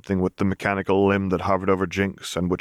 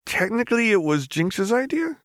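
nervous. Acted. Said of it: "Echo said nervously".

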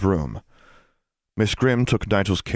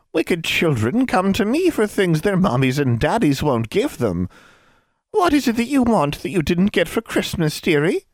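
high, sweetly coaxing hag voice; crone's coaxing murmur; 11 semitones higher than usual. Acted. Old crone coaxes Alexandra with promises, sweet hag voice. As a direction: lure the child in like a kindly witch. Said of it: "the old crone murmured".

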